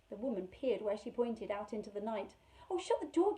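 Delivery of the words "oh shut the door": frightened cry. Acted. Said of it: "Carrie cried".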